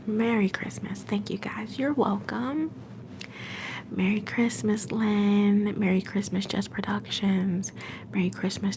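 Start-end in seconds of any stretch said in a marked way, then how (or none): none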